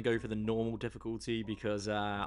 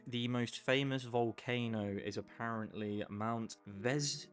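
ordinary talk, nothing marked

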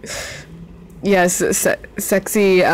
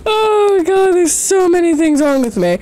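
laughing self-mockery; 11 semitones higher than usual. Real, not acted. Laughing at herself.

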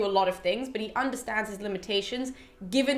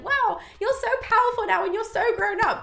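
high-pitched gushing; mock admiration; 10 semitones higher than usual. Imagined assembly praising Telemachus, mock gushing. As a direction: coo it way up high as fake praise. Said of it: "they're not being like".

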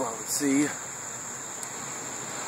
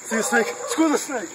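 higher and higher, hyped-up babble; wired and jittery; 7 semitones higher than usual. Acted. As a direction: climb in pitch like a caffeine spike. Wired, climbing in pitch.

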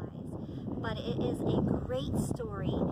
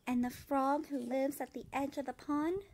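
put-on gentle, even read-aloud voice for children; storybook reading; 2 semitones lower, 4 dB quieter than usual. A narrator's reading. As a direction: read it like bedtime story time. Reads picture book aloud.

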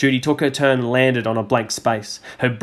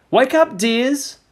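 much higher, slow lilting call; gentle wake-up call; 12 semitones higher, slower than usual. A narrator's reading. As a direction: coax the sleepy children awake. Mother waking the children.

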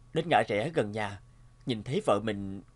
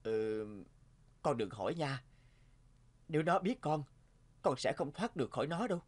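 hushed warning; 7 dB quieter than usual. Acted. Father hushing his child about the demon, quietly. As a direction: warn the child under your breath.

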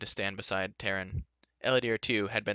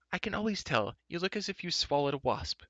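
put-on higher, teasing girl's voice; Elanwe's voice; 8 semitones higher than usual. A narrator's reading. Young girl teasing her friend, higher and playful. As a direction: tease him like a knowing young girl.

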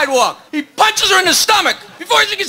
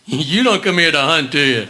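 low gruff bear voice, much lower; 12 semitones lower than usual. Acted. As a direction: drop way down for the bear.

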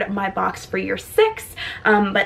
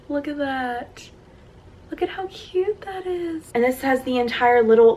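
normal talking voice

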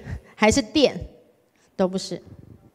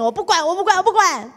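higher, louder whine of refusal; childish whining; 8 semitones higher, 5 dB louder, faster than usual. Retold or imitated. Heartbroken girl sulking at God, childish whine. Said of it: "老娘失恋了".

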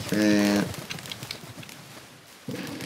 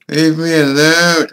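louder, higher, slowed plea; panicked shout; 6 semitones higher, 5 dB louder, slower than usual. Real, not acted. Player chased by an army of termites, loud panicked shout. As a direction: yell it while backing away. Ant army chasing him.

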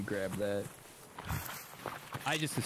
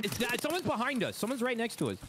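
alarmed; 13 semitones higher than usual. Real, not acted. Enemy suddenly right behind them.

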